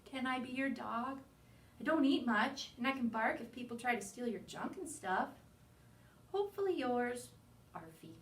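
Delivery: plain reading voice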